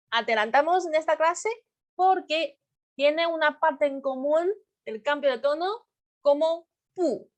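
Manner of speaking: ordinary talk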